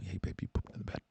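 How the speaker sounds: soft whispered news; gentle waking. Acted. Boyfriend gently wakes girlfriend with bad news, whispering. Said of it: "Oh, you go".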